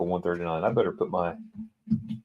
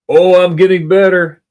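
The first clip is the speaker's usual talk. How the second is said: delighted; 11 semitones higher, 11 dB louder than usual. Real, not acted. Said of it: "proud of progress".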